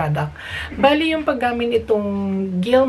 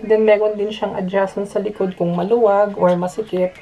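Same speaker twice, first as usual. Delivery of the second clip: muffled behind respirator; speaking inside rubber mask; through a face mask. Real, not acted. Seen 1: Respirator covers mouth and nose throughout labelled speech.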